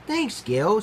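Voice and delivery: high, bright little squeak of thanks; happy squeak. A narrator's reading. Gwen thanking Gil for lunch, squeaking happily. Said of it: "squeaked Gwen happily".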